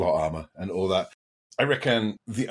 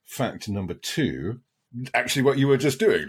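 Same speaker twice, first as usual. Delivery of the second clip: ordinary talk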